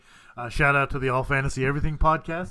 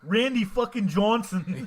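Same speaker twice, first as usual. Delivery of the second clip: slow, higher-pitched Boston accent; 7 semitones higher, 3 dB louder, slower than usual. Shown as a demonstration. Host taking another turn at the name game, slow Boston accent. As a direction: stretch the name out in mock Boston. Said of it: "you say it with a Boston accent".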